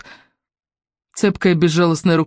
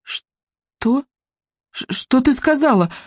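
startled; 7 semitones higher, much slower than usual. Acted. Heroine startled by the echo.

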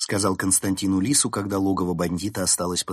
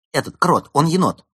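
higher, louder correction; emphatic explanation; 7 semitones higher, 7 dB louder than usual. A narrator's reading. Fox first explaining the name, emphatic. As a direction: state it emphatically, raised voice.

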